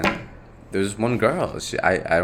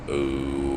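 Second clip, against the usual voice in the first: long, low sustained "oh"; deep humming exercise; 6 semitones lower, 5 dB quieter than usual. Shown as a demonstration. Speaker showing his voice-deepening exercise, long low drone. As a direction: hold a low, droning vocal tone. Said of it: "that's supposed to make your voice a little bit deeper".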